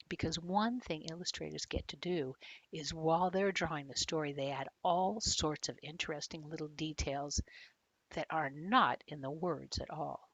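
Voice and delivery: ordinary delivery, nothing marked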